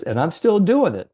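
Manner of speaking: a hint of the old man's pride; old salesman's voice. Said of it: "how he talked to".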